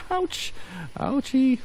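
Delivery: squeaky high-pitched mock yelp of pain; play-hurt in a tiny high voice; cartoonish high squeal as the trap hits; mock ouch. Pretend hurt by trap in game.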